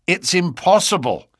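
startled but contained refusal; incredulous objection. A narrator's reading. Inspector objects to draining moat.

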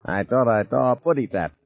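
squeaky cartoon voice. A narrator's reading. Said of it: "His own voice sounded squeaky, like a cartoon character's".

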